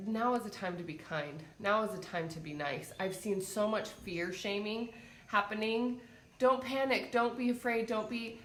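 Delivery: usual speaking voice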